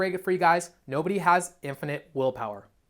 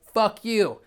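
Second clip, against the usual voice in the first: angry curse; 5 semitones higher, 4 dB louder than usual. Retold or imitated. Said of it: "you're like".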